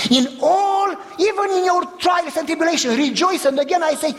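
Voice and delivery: high, ringing pulpit cry; preaching at full force. Acted. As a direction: lift the congregation with it.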